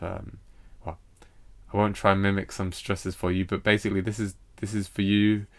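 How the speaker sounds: ordinary talk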